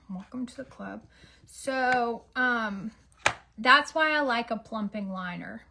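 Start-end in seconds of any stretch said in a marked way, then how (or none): 0.1-1.0 same words said again much softer; muttered repeat. Shown as a demonstration. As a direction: say it again under your breath.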